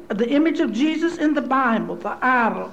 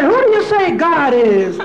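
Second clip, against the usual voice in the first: preacher's call; 4 semitones higher, 7 dB louder than usual. Retold or imitated. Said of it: "He said".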